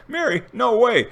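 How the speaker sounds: high, loud mock disbelief; retold disbelief. Dreamer doubting Mary will come, high mock disbelief. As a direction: toss it up high like you can't buy it.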